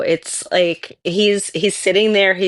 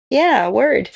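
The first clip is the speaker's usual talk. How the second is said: dog voice; 7 semitones higher, 3 dB louder than usual. Pretend. Owner voicing her dog.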